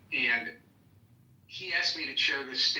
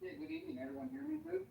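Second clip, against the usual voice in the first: muffled, far from the microphone; distant, muddy mic sound; muddy boomy voice; 10 dB quieter than usual. Real, not acted. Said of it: "You sound a little muddy".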